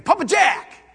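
bright call of a name at the door; a father cheering to his little son at the door, excited call; excited greeting. Retold or imitated. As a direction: call out happily, like a child's dad announcing a visitor. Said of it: "That's my name".

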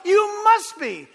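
"must" lifted high and slow; emphatic quote. Shown as a demonstration. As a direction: stretch out "You must be" with weight. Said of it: "Jesus said it himself".

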